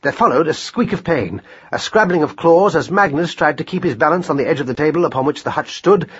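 ordinary delivery

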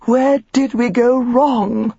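hammed-up sobbing Mrs Elliot; sobbing mother. A narrator's reading. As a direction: wail it like a hysterical society wife. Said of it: "Missus Elliot sobbed".

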